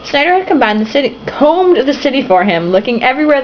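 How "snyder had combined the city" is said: plain reading voice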